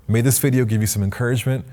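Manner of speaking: normal talking voice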